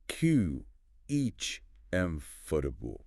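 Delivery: "Q H M for dubu" as kumf-ter-bul, broken up; accepted variant. Shown as a demonstration. Co-host giving an acceptable version, slow pronunciation demo. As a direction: sound out "Q H M for dubu" slowly. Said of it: "You'll hear".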